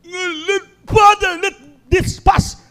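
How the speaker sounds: high, loud pleading cry to the Father; anguished prayer. Acted. Jesus praying in the garden, crying out high. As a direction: beg as if in agony. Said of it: "with strong crying and agony".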